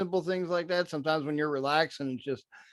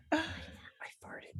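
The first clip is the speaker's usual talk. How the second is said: unvoiced whisper, embarrassed; sheepish whispered admission; whispered confession; 11 semitones higher, 15 dB quieter than usual. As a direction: breathe it out as a secret. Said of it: "She's like".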